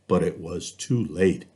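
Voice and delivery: plain reading voice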